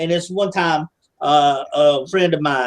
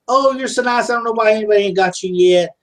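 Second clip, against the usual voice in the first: mocking girls' sweet voice; 4 semitones higher than usual.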